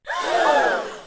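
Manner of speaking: high gasp of mock shock. Guest mocks her ex's excuse with a gasp. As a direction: gasp for effect at his excuse.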